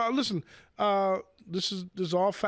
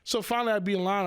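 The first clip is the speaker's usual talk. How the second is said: ordinary talk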